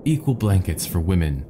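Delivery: plain reading voice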